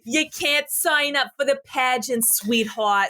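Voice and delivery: put-on snooty pageant voice; stuck-up pageant lady. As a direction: talk down like a stuck-up pageant official. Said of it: "stuck-up, uh, s-, pageant lady".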